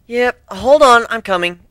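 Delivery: answering a knock from across the room; calling out. Acted. Calling through the closed door.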